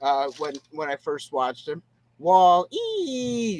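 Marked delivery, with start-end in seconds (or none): 2.2-3.6 high robot voice with a drawn-out final vowel; Wall-E. Retold or imitated. Retelling first seeing the droid, high robot imitation. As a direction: imitate a little movie robot calling its name. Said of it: "he reminded me of Wall-E".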